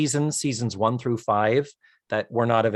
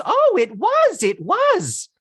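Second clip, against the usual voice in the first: squeaky high, much higher; shrill excited lady; very high gushing voice; 4 dB louder, slower than usual. Retold or imitated.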